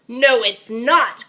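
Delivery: loud, slow defiant cry. Acted. Greedy dinosaur crying back defiantly, loud. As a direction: snap back hard. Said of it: "cried Minosaur".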